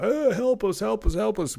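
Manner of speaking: high, whiny mock cry for help; mock plea. Mocking Poland begging the world for help, whiny. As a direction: ham up a pathetic cry for help.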